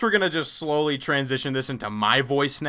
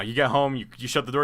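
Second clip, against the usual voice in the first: usual speaking voice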